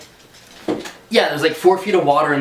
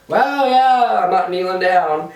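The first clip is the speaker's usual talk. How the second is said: high, wary no-thanks; nervous refusal to kneel by the pit; 5 semitones higher, 3 dB louder, slower than usual. Retold or imitated. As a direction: turn it down high and uneasy. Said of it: "I was like".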